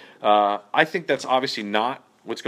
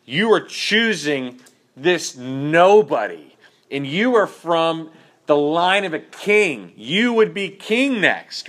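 slow, a touch higher, hint of an angry father; mild mock angry king; 5 semitones higher, slower than usual. Saul scolding his son Jonathan, lightly voiced anger. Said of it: "he is angry".

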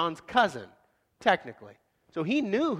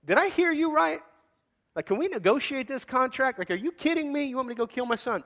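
much higher, half-laughing whoa, whoa, are you kidding me; incredulous disbelief; 8 semitones higher than usual. Retold or imitated. Believer balking at God's command, incredulous high mock protest. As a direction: balk at the order in mock disbelief. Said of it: "we'd be like".

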